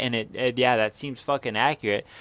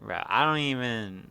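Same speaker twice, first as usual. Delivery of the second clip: ordinary talk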